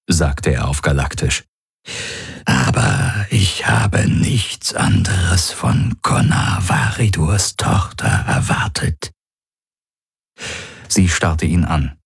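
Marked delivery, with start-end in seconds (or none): 2.5-9.1 slow, rasping creature voice; rough, voiceless, drawn-out menace; menacing alien predator. Acted. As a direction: savour each word as a grinning alien hunter.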